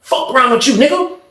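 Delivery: high, goading trash talk; talking greasy. Retold or imitated. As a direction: provoke him with every word. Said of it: "he started talking greasy to Milk".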